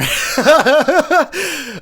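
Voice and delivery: high cackling laugh at his own joke; big laugh; loud burst of laughter. Real, not acted. Host laughing at his own callback, loud cackle.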